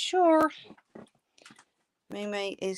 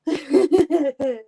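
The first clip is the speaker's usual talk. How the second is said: loud laugh; 7 semitones higher, 8 dB louder than usual. Real, not acted. Laughs at chat joke.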